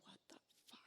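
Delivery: breathless whispered curse; shocked whisper. Retold or imitated. Alone in the bathroom mirror after the news, a stunned whisper. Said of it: "looking in the mirror going".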